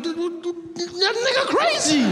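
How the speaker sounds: stammering, then high disbelief; shocked stutter then disbelief; stunned. Pretend. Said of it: "looking at the person like".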